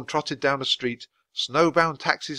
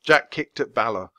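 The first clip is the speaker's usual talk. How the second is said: usual speaking voice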